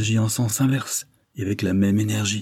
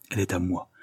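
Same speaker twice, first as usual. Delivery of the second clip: ordinary delivery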